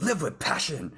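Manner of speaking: high, pumped-up motivational line; mock guru slogan. Mimicking his old motivational persona, hyped. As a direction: sell it like a big stage coach. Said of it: "And I would speak".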